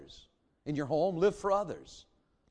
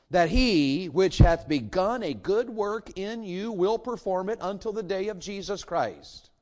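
emphatic scripture reading; 5 semitones higher, 4 dB louder, slower than usual. Acted. Reads Paul's reply with emphasis.

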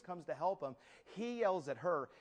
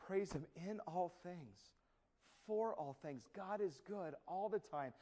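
soft exhortation; 7 dB quieter than usual. Real, not acted. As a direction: lay it gently on them. Soft exhortation after pause.

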